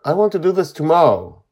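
put-on high, loud insistent child. A narrator's reading. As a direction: insist like a kid digging his heels in. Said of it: "Charlie insists".

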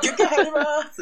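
high, loud mock DJ call; DJ break announcement. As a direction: announce the break like a hammed-up radio host. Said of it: "その間結構音楽流して休憩入るからな".

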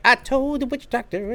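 high mock singer voice. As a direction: sing it like a clueless guy. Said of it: "he's just like".

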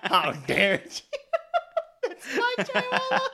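indignant through laughter; laughing mock outrage.

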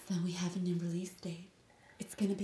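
soft unvoiced whisper. Real, not acted. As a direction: whisper so headphones don't hurt. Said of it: "whoever just listened to that in their headphones".

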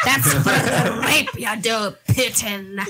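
loud, hammed-up stage witch; mock an accent and land on a witch; witch from Hamlet. Mocking a friend's Russian accent, witchy voice. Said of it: "that was like a witch from Hamlet".

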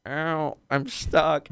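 imitating a webbed enemy, high and small; whiny stuck enemy. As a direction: play a webbed-up goon whining. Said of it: "they're just like".

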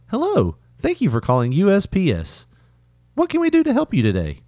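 high, chirpy automated greeting; robotic phone agent. Retold or imitated. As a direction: imitate a recorded customer-service voice. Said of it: "This sounds robotic".

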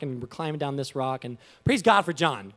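ordinary talk

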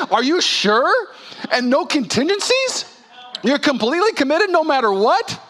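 incredulous. Pretend. Preacher as doubting realtor, high incredulous laugh. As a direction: act stunned that anyone would commit blindly. Mock realtor questioning God.

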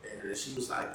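ordinary talk

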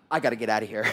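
low-pitched escape line; deep, deadpan urge to flee; urgent aside. Retold or imitated. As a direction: drop low, as if plotting a getaway. Wanting to escape being volunteered.

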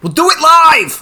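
hammed-up O'Reilly meltdown; Bill O'Reilly rant. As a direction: bellow it like a TV host losing it.

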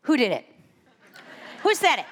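mock warrior-mom demand; retold indignant mother. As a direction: play a mother demanding a culprit. Protective mother retelling her reaction.

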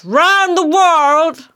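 high, drawn-out rousing call; old lion's cry. Acted. Ahab turning to the steersman, high drawn-out cry. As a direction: cry it out long and high to the crew. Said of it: "cried out in his old lion voice".

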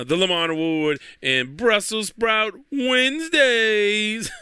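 slow, drawn-out announcer cadence; sing-song cadence. Real, not acted. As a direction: stretch it out like a catchphrase. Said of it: "sort of a similar cadence".

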